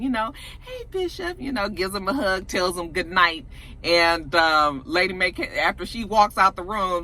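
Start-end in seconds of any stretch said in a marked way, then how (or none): 0.6-1.4 high, soft, sweet greeting; put-on happiness. Zora's mama greeting Bishop as if nothing happened, falsely happy. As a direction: imitate a suddenly happy hello, pitched up and small. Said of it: "was all happiness again".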